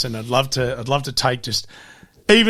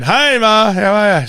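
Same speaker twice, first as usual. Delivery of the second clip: higher, louder cheery hello; bright phone greeting; 8 semitones higher, 7 dB louder, slower than usual. Retold or imitated. Son ringing mum, chirpy phone greeting. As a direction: sound chirpy to get the kids back.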